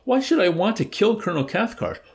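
very high, loud disbelieving shout. A narrator's reading. Dobbs shouting back at Yossarian, high shout. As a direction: shriek the question in alarm. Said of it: "shouted Dobbs".

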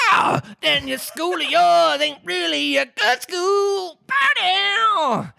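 high, slow, belting croon; relieved Mock Turtle. A narrator's reading. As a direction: belt it out with relief. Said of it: "in a tone of relief".

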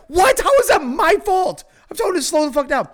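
high, loud retold objection; indignant protest. Blamed passenger defends himself to friends, indignant high yelling. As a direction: protest the blame loudly, pitch high, speeding up at the end.

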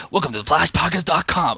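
a lightly put-on radio announcer read. Shown as a demonstration. Podcast host tries out his radio voice on cohost, mock announcer read. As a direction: slip into your smooth on-air host voice for one line. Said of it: "maybe on Earth Two I do have a good radio voice".

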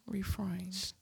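breathy whisper of the word Refined, no voice; just breathe Refined softly. Shown as a demonstration. To her co-host, trying the word again in a whisper.

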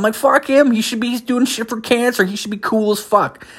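high-pitched mock outrage; indignant rant. Podcaster scoffing at the runner's anger problem, high indignant rant. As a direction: pitch shoots way up in indignant disbelief.

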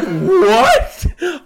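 high, loud laughing what; retold laughing disbelief; shocked disbelief. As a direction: squeak it out in stunned surprise. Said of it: "I was like".

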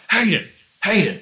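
high, loud calling a name. Retold or imitated. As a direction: call out to wake someone. Calling a sleeper through the house.

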